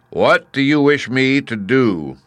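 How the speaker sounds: loud, slow, high-pitched commanding voice; booming Great Head. A narrator's reading. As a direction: boom it out like a giant oracle. The Great Head Oz questions Dorothy.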